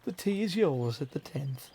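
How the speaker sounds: weak British accent attempt. Shown as a demonstration. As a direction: tries British, voice too worn. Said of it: "my British is still gone with my voice".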